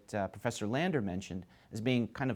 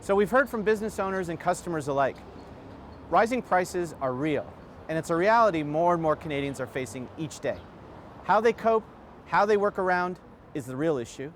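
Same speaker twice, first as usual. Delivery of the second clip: higher, louder presenter read to wrap up the report; news report sign-off; 6 semitones higher, 4 dB louder than usual. Acted. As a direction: sum it up like a TV reporter, projected and emphatic.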